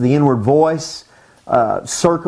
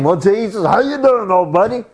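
mock chummy hello, higher; breezy, irreverent buddy-to-buddy greeting; overfamiliar greeting; 4 semitones higher than usual. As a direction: greet him like an old pal, jumping up in pitch. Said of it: "they weren't just saying".

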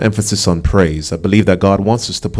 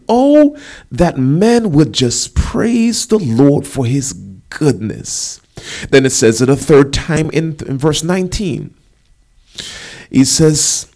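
raised pitch, key words drawn out long; emphatic preaching; 5 semitones higher, 3 dB louder, slower than usual. Real, not acted. Preacher urges the congregation to see the value of praise, emphatic preaching. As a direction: preach it out, swelling on each big word.

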